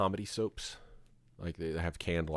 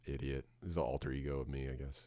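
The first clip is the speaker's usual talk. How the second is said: ordinary talk, nothing marked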